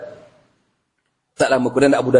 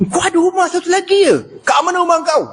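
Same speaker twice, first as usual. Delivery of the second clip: much higher, probing demand; stern Umar; 14 semitones higher than usual. Acted. Umar demands to know where the house is. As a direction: press him hard for the address. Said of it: "jaga Omar Al-Khattab".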